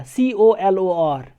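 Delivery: slow letter-by-letter spelling, falling at the end; slow teacher spelling; spelled out slowly. Shown as a demonstration. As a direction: dictate each letter slowly. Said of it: "American English में इसको कैसे लिखा जाएगा".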